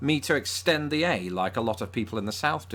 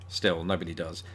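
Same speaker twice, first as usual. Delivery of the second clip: ordinary talk, nothing marked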